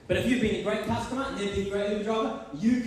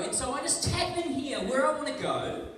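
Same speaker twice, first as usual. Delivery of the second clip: normal talking voice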